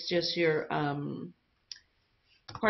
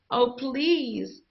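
high, desperate plea for help; pleading cry; 7 semitones higher than usual. A narrator's reading. Mother begging the villagers, high pleading cry. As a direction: beg for your child's life. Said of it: "his mother cried".

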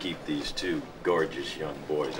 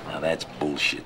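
ordinary delivery

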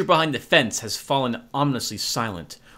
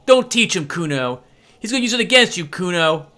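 put-on higher, louder taunting kid voice; jeering girl; 5 semitones higher, 5 dB louder than usual. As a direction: needle him from behind the fence. The girl behind the fence warns.

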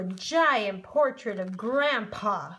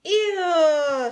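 high, loud disgusted squeal; 6 semitones higher, 8 dB louder than usual. Acted. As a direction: recoil in disgust. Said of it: "said the other naked mole rats".

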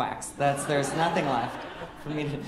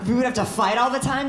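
higher and louder, stress on all; outraged disbelief; 6 semitones higher, 6 dB louder than usual. Real, not acted. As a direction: still stunned years later, throw it back. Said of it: "That's ruined me for years".

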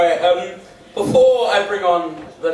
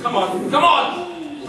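urging; 6 semitones higher, slower than usual. Real, not acted. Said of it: "Everyone say it with me".

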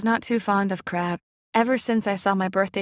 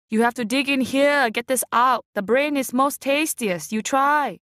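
aunt demonstrating; 5 semitones higher than usual. A narrator's reading. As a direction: show him how, bossy and eager. Aunt demonstrating crab technique.

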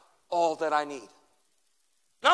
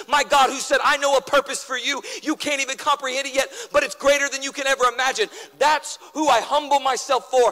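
rising, louder preaching about God; preaching at full force; 3 semitones higher, 3 dB louder than usual. Acted. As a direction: build it up like a sermon climax. Preacher voicing humility, building.

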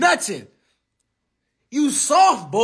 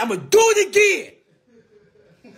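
laughing taunt; 8 semitones higher, 3 dB louder, much slower than usual. Real, not acted. Taunting rival who might deny it.

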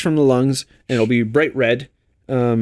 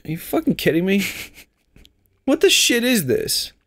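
higher, mock incredulous reaction; retold disbelief; 5 semitones higher than usual. Viewer reacting to the episode's end, mock disbelief. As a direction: ask it in put-on disbelief. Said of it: "and I'm like".

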